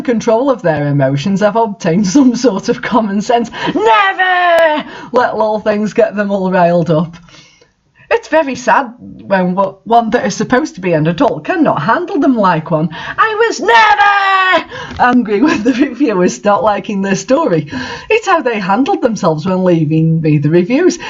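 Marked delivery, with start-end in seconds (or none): 3.5-7.2 slow, stressing "Never"; slow emphatic lecture. Real, not acted. Author lecturing on self-control. 12.9-17.7 emphatic outburst. Real, not acted. Author denying anger at reviewers.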